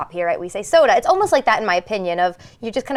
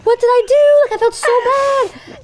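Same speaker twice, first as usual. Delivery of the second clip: mock mortified; 13 semitones higher, 5 dB louder than usual. Retelling embarrassing phone mimicry.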